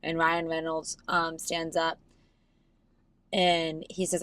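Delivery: usual speaking voice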